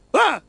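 high, loud startled yelp; mock scared scream. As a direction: imitate someone jumping in fright. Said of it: "hearing him scare himself".

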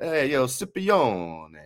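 drawn-out, hammed-up Italian-American call; mock Italian-American. Co-host imagining a fan teasing the guest, mock Italian-American. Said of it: "Where's your Italian at?".

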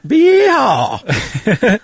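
very high, drawn-out cartoon yell; mock gun-firing whoop. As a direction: whoop it like a pistol going off. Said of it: "always shoots his gun off".

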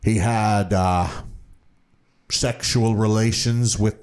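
lightly voiced old police detective, slow and halting; mock-deliberate retelling with awkward pauses; weird repetitive detective. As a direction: draw out each phrase like a documentary detective. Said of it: "saying it in such this weird, repetitive".

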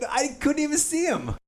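high exasperated shout; 1 frustrated shout. Retold or imitated. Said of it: "I'm like".

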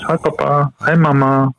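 put-on high, sing-song little kid greeting; toddler voice. Dad imitating his toddler waking them up, high and sing-song. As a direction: chirp it like a two-year-old at dawn.

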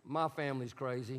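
low, soft admission; quiet confession. Shown as a demonstration. Pastor coaching the congregation, said low and soft. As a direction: murmur it like a confession. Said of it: "and just say".

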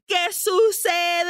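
high, panicky imitation; mock freaking out. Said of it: "where she is freaking out".

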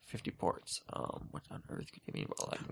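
whispered mock confusion. Retelling a salesman's pitch, a whispered mock bafflement. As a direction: breathe it out, baffled. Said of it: "I'm like".

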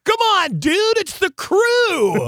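high, loud, drawn-out holler; exuberant fan yell. Retold or imitated. Fan excuses his punch to the biker, exuberant yell. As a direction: yell it like a crazed concert fan.

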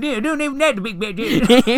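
silly high voice. Pretend. High silly voice continuing bit.

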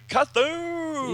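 high, slow comic-book boom; drawn-out explosion noise. Acted. Beam hits headquarters, long high blast noise. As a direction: stretch it out like a huge blast. Reads out explosion sound effect.

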